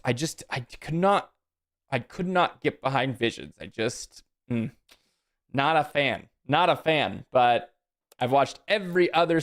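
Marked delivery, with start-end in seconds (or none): none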